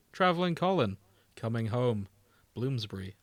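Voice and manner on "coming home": ordinary delivery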